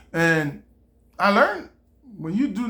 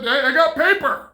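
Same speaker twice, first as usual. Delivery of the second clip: proud inventor; 7 semitones higher, 5 dB louder than usual. Retold or imitated. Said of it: "Somebody came up with".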